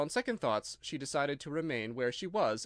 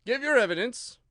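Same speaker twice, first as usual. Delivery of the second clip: louder, higher angry command; angry royal demand; angry king; 9 semitones higher, 8 dB louder than usual. A narrator's reading. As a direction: repeat it in royal anger. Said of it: "the king repeated angrily".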